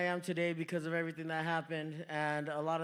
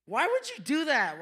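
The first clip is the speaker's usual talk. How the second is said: high, louder mock outrage; lightly voiced scandalized shopper; offended lady; 8 semitones higher, 7 dB louder than usual. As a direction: gasp it out indignantly. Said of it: "She was like".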